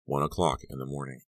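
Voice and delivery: ordinary delivery, nothing marked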